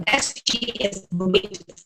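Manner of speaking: choppy, breaking-up audio; glitchy robotic call. Real, not acted. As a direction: audio cutting out. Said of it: "your audio is, uh, breaking up".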